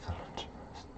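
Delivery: breathy whispered word; hushed whisper of a title. Real, not acted. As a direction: whisper it to yourself. Reading a word under the breath.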